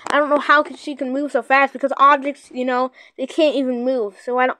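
normal talking voice

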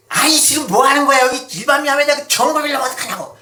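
high, loud scolding yell; shrill-free loud rebuke from the rice keeper; scolding deacon. Retold or imitated. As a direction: bark it out like an angry church deacon. Deacon scolding mother in story.

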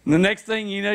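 usual speaking voice

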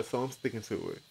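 normal talking voice

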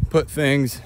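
normal talking voice

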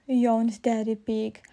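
ordinary delivery, nothing marked

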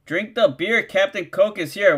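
ordinary talk, nothing marked

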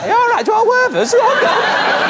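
mock fan shouting. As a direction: yell it like a crowd of fans outside a hospital. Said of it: "Two thousand people like".

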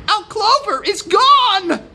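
very high, loud rooster outcry; crying out. A narrator's reading. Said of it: "cried the rooster".

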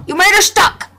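high, loud accusation; accusing shout. Real, not acted. Player yelling at the game for trapping her, angry. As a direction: yell the blame at the game.

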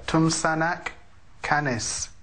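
Tumsanak Canis, stress on Tum; native thanks demo. Shown as a demonstration. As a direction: say Tumsanak Canis slowly and clearly. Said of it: "how do you say it".

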